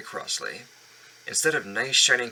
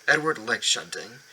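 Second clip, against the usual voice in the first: plain reading voice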